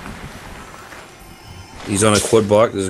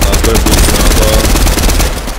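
drawn-out call; 9 dB louder, slower than usual. Acted. Drawn out loud reply.

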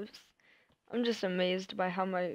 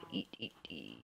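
much lower and much softer eat eat eat; deep, soft creature chant fading away; eating noises voice; 16 dB quieter than usual. Pretend. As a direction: growl it down low like a hungry beast. Voicing the game's hungry animals.

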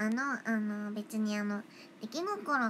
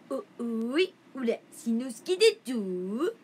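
louder, slow cartoon boy voice; Shinnosuke impression; 7 dB louder, slower than usual. For the fans, a loud slow cheeky Shinnosuke. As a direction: call out like a cheeky cartoon kid. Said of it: "しんのすけ似てる？".

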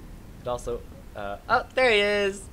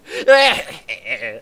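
loud evil laugh; 12 dB louder than usual. Retold or imitated. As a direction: cackle like the villain NPC. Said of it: "have the worst evil laugh".

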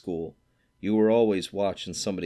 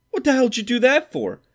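much higher, louder outraged shout; outraged yell; 9 dB louder than usual. A narrator's reading. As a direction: yell at him for tearing it up. Stradlater shocked, essay torn up.

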